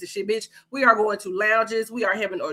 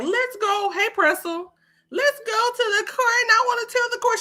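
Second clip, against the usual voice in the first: put-on much higher, eager kid voice; mocking Robin; 12 semitones higher than usual. Imitating Robin running to tell the court, high and eager. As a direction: play the girl, all keyed up and tattling. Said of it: "What do you think Robin is gonna be like?".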